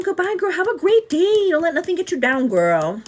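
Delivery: high, bright sing-song farewell; sing-song goodbye. Real, not acted. Cheery goodbye to viewer.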